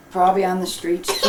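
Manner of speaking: usual speaking voice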